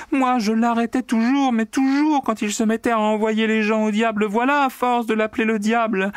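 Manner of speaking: very high, tearful wailing complaint; crying out. A narrator's reading. Secretary wailing to the accountant about her boss, very high. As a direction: wail it high and desperate. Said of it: "et criait".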